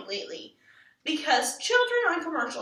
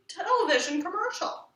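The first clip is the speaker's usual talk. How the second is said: usual speaking voice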